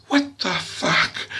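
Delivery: slow, high, emphatic disbelief; mock exasperated swearing. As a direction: stretch it out in acted exasperation. Retold swearing at mother.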